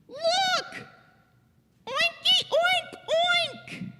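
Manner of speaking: very high-pitched piggy squeals; excited Piggy. Acted. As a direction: squeal out gleeful oinks. Piggy excitedly oinks to Gerald.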